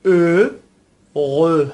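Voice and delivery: "ا، غ" slowly split into rounded eu and throaty r-eu; French pronunciation. Shown as a demonstration. Teacher models the French word for happy, "ا، غ" syllable by syllable. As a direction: draw out "ا" loudly, pause, then "غ".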